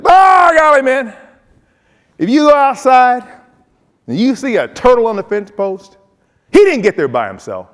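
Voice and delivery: high, loud, fast-talking coach voice. Retold or imitated. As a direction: blast it out like an excited Southern coach. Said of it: "He'd say things like this".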